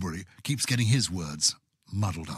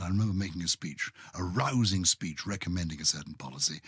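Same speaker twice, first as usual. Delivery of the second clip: normal talking voice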